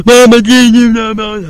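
put-on high, loud childlike holler; calling mama. Calls out to mama.

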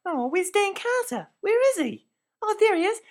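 high, excited squeals for a player; fangirling. Acted. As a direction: gush over her favourite player. Fangirling over Dan Carter.

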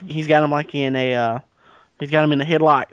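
ordinary delivery

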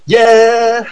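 high, drawn-out cheer; excited cheer. Real, not acted. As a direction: let out a high whoop of a yeah. Cheering for the Boosh.

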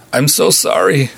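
high, tearful cry of sorry; blurting it out through tears; weeping apology. A narrator's reading. Nurse weeping to her patient, high tearful cry. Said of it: "openly weeping young nurse".

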